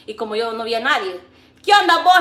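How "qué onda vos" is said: loud, high, gruff street greeting; gruff big voice. Retold or imitated. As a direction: throw it out like a tough guy. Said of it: "la gran bozarrón que se me oyó". (saludo con vozarrón)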